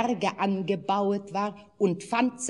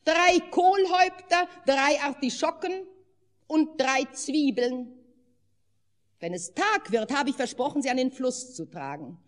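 put-on high, louder, upset old woman's voice; agitated wife; 7 semitones higher, 4 dB louder than usual. A narrator's reading. The wife tells her husband about the will-o'-wisps, agitated. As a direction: pour out the complaint breathlessly.

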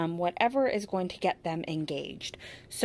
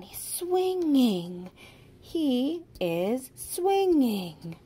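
"swinging" louder and drawn out slowly; stress on swinging; 5 semitones higher, 4 dB louder, much slower than usual. Shown as a demonstration. Teacher stressing the missing ending for a child, emphatic. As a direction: lean hard on the ending of swinging. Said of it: "put that ending on".

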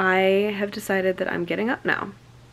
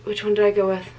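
ordinary talk, nothing marked

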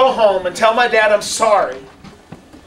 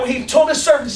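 normal talking voice

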